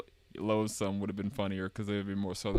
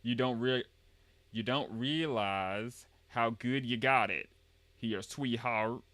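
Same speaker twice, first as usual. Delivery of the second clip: slow, drawled Southern grandpa; Southern accent; slower than usual. Acted. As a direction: stretch it out in folksy Southern. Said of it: "talking to my Southern speak".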